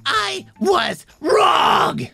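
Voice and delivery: dramatic outrage. Acted. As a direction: ham up the betrayal. Complainer's dramatic twist.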